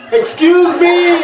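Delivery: high, slow calling-out shout. Retold or imitated. Stuck guest calling from the bathroom, a high, slow call.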